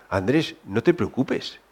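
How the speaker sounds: gentle comfort. Retold or imitated. Father comforting crying son.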